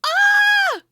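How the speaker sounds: high excited squeal; delighted squeal. Real, not acted. Squeal at the proposal story.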